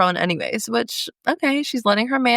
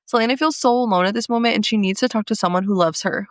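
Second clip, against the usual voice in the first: usual speaking voice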